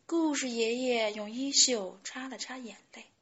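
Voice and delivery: plain reading voice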